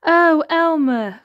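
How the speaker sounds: high, loud, drawn-out cry; pleading call. A narrator's reading. Wilbur calls after departing Elmer, pleading call. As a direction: wail the name after someone leaving. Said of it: "called Wilbur".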